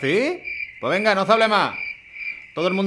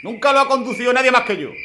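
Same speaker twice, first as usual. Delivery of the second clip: raised, insistent no's; adamant refusal; 7 semitones higher, 4 dB louder than usual. Acted. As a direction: refuse flatly, pitch up and pushing. Trucker refuses to give up truck.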